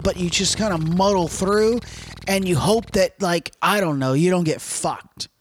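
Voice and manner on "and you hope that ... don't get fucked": usual speaking voice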